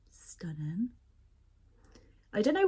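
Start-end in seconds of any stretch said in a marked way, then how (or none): 0.1-0.9 whispered with a soft rise; admiring whisper. Real, not acted. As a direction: breathe it out in awe. Admiring the soap.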